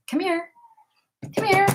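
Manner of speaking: high coaxing pet voice; high-pitched dog coaxing; pet-talk coaxing. Real, not acted. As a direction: coax the dog up in a high sing-song.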